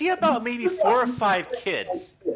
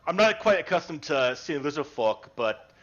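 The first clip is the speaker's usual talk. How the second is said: lower, unhurried humble fisherman; gruff-free friendly old fisherman, lower voice; fisherman Dale; 8 semitones lower than usual. Acted. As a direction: speak as a polite, simple fisherman.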